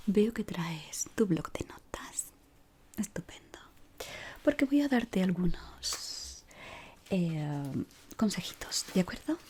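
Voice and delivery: normal talking voice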